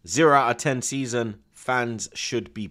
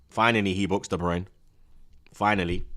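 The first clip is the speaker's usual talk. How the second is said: ordinary talk, nothing marked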